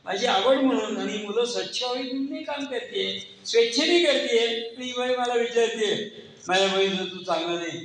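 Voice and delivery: high, rising angry protest; indignant outburst. Retold or imitated. Teacher fuming at the mother's complaint, indignant outburst. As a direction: let the hurt pride boil over. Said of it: "अनुताईंना असा संताप आला".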